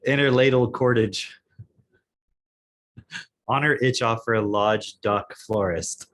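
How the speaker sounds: slow, halting read of nonsense words; word-by-word literal reading. Shown as a demonstration. Cohost reads the garbled fairy tale aloud, slow and literal. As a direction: read each odd word just as written. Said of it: "I'm just reading it as the words".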